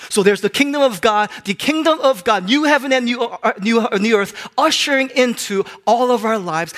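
loud, high, forceful proclamation; preaching. Real, not acted. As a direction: proclaim it to the whole congregation.

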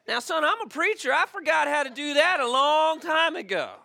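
hammed-up preacher protest; preacher's reply. Preacher voiced in joke.

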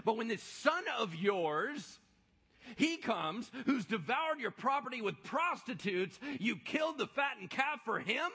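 loud, high indignant complaint; resentful older son. Acted. Older son protesting to his father, loud and indignant. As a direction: throw the years of service back at him.

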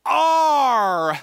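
"Are" held long and loud; emphatic ARE. Shown as a demonstration. Preacher driving home the key word, emphatic "Are". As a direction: stretch "Are" into a ringing call.

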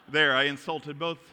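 ordinary talk, nothing marked

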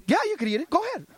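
high, teasing go-ahead; mock permissive. Coach teasing wrestler about eating.

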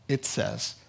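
ordinary delivery

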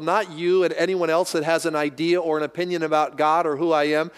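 ordinary talk, nothing marked

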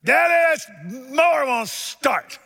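put-on high, loud boyhood yell; yelling for Daddy. Boy yelling inside to his father about the mower, loud high shout. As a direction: shout it across the house to Daddy. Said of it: "And I yelled inside".

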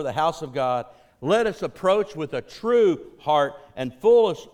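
emphatic scripture proclamation; preached scripture reading. Acted. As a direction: proclaim the passage to the congregation.

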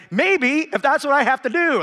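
mock-determined reply to a friend; emphatic. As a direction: press the point with a grin. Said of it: "I'm like".

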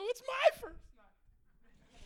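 put-on very high, drawn-out whiny echo of the boy; mocking boy's plea. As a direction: stretch it out like a wailing kid mimicking him. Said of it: "I go".

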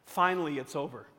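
ordinary talk, nothing marked

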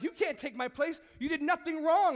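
high, loud desperate objection; anguished protest. Acted. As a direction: cry out to stop him. Child protesting father's sacrifice in story.

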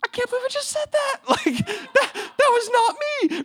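very high, squeaky mock panic; scared. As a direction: squeal it like you regret it instantly. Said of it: "scared [name] mode".

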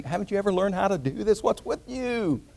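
high-pitched jeer without extra volume; mocking ridicule. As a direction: taunt them, stretching the last question. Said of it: "mocking and ridiculing".

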